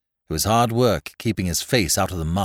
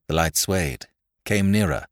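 ordinary delivery

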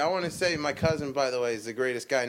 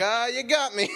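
mock caught-out cry; 9 semitones higher than usual. Cousin caught wanting another sweetie, high laughing yelp. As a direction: play the cousin caught, half shouting through laughs. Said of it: "And you're like".

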